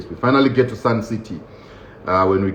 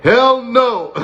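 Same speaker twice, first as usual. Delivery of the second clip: high outraged refusal; 10 semitones higher, much slower than usual. Retold or imitated. Comic telling the prisoners he refuses, high outraged hell no. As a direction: squeal it like the idea is unthinkable. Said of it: "I'm like".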